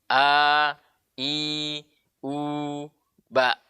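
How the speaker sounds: A, I, U let out freely, BA stopped short; freely released voice. Shown as a demonstration. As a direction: sustain each vowel unpressed and end "ba" abruptly. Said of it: "harus lepas suara".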